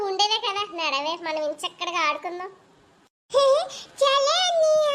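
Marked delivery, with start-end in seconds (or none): 3.3-4.0 put-on high, loud little-girl giggle; a short gleeful kid laugh; childish giggle. Little sister giggles at her mother's scolding, high and loud. 4.0-4.9 put-on higher and louder childlike urging; a kid sister tugging her brother along; little sister voice. Little sister urges her brother to come play, higher and louder. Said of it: "అన్నకు తగ్గ చెల్లులు".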